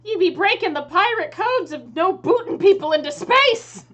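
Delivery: high, loud outraged pirate captain; scandalized pirate shouting; aghast pirate. Acted. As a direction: cry out in appalled pirate speak. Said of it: "she looks at you aghast and says".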